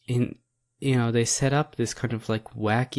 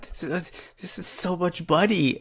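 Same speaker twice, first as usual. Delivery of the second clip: put-on high amazed girl; 7 semitones higher than usual.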